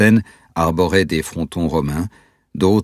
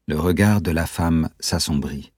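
ordinary delivery, nothing marked

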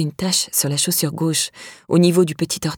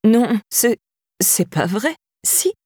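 cracked, pleading disbelief; stunned voice catching in the throat; voice breaking; 4 semitones higher, slower than usual. A narrator's reading. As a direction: let the voice crack on the denial. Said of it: "la voix cassée".

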